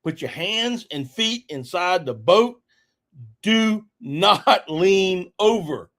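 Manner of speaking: slow, deliberate warning to keep limbs in, a laugh slipping through; stern warning. Retold or imitated. Boat driver warning his passenger in the swamp, slow and stern. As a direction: spell it out slowly so he listens. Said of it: "I said".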